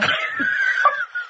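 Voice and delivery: very high squealing laugh; real breathless shriek of laughter. Real, not acted. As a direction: let out a helpless squeal of laughter. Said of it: "I've lost control here".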